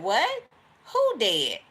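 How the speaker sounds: loud stunned what, then who dead; shocked disbelief. Retold or imitated. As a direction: blurt it out in total shock. Someone shocked, asks who died.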